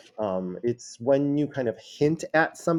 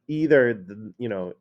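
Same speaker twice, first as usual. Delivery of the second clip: usual speaking voice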